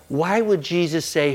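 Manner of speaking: normal talking voice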